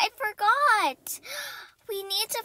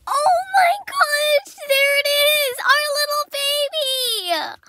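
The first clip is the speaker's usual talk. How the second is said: put-on high, loud, slow gushing over the baby; overjoyed; 7 semitones higher, 7 dB louder, slower than usual. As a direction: melt with excitement at the newborn. First sight of the foal.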